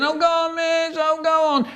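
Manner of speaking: very high, long-drawn begging whine; pleading whiny kid. Retold or imitated. Pupil begging miss to relent, high pleading whine. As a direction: wheedle like a nagging schoolchild.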